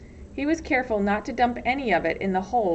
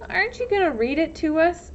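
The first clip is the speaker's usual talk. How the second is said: put-on pestering boy; 8 semitones higher, 4 dB louder than usual. A narrator's reading. Armpit pestering Stanley.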